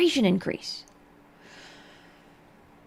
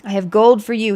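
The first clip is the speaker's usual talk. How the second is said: put-on young smuggler voice; 8 dB louder than usual. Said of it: "he said to her back".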